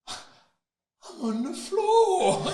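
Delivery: very slow, high laughing line; laughing dazed recall. Real, not acted. Boxer laughing while recalling the knockdown. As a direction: laugh through the knockdown memory.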